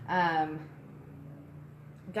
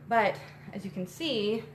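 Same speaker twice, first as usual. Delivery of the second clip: ordinary talk, nothing marked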